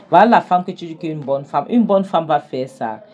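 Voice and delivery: loud, sarcastic jab about the good wife; angry retort. Acted. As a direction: throw the words back at him, loud and bitter. Jonathan argues with Kenny.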